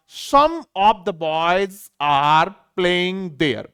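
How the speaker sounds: very slow, word by word, as a teacher reads from the board; slow reading aloud. Shown as a demonstration. Teacher reading the example sentence to students, very slow. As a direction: spell it out slowly for the class. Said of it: "जैसे आपने कई बार पढ़ा होगा".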